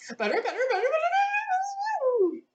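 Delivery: rising to a very high squeak, slowing. Acted. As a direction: climb into a squeak. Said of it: "till it got to the squeak".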